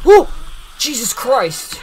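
sudden frightened yell, drawn out; jump-scare shriek, real fright; startled scream. Real, not acted. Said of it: "Scared me".

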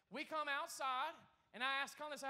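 usual speaking voice

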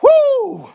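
high, loud "woo" between breaths; breathless whoop. Real, not acted. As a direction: let out a winded whoop. Catching breath after exertion.

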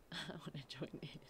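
breathy laughing whisper. Real, not acted. Laughing aside to the table.

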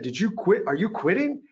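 imitated instructors taunting a quitter; mocking instructors. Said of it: "started making fun of me".

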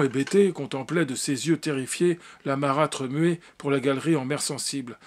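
usual speaking voice

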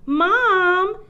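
put-on high, loud child's call. A narrator's reading. Nicole calls mother at bedtime, calling out. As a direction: call for mom from bed.

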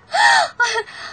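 shrieking burst of delighted laughter; high delighted shriek of laughter; high giddy laugh. Acted.

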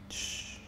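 soft, hushed "shh"; soothing shush. Acted. Boyfriend calming crying partner, soothing shush. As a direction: gently shush someone in tears.

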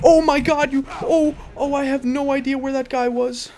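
loud, high, sudden alarm; startled shout. Real, not acted. Gamer startled by an enemy right next to him, yelling. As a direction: jump out of your skin and yell.

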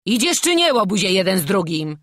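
very high, loud start; angry shout. A narrator's reading. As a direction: yell impatiently at the rascals. Captain bullies pleading boys onward. (gniewne ponaglenie krzykiem)

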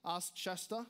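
usual speaking voice

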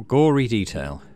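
usual speaking voice

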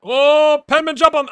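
urgent yell. Real, not acted. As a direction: yell at the player to jump on it. Yelling at player live.

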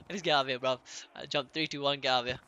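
usual speaking voice

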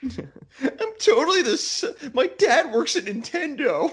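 put-on high bratty teen voice with laughter; bratty kid brag, laughing; whiny teen voice. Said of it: "that whiny teen voice".

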